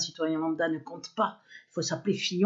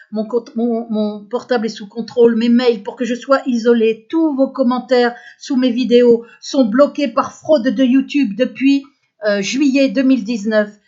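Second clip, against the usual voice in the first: loud, raised-pitch real grievance; indignant protest; 4 semitones higher, 10 dB louder than usual. Real, not acted. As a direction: protest loudly, voice pushed up. Dénonce son isolement et le blocage. (protestation indignée, forte et haut perchée)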